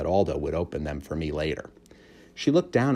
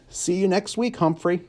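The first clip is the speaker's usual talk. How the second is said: cheerful janitor; 12 semitones higher than usual. A narrator's reading. Aldo's voice.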